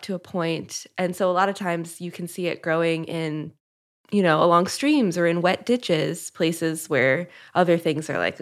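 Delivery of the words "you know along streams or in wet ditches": usual speaking voice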